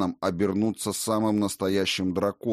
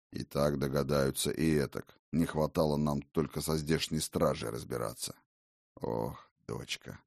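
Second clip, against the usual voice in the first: weary father; 5 semitones lower, 5 dB quieter than usual. Acted. Father sighs at daughter.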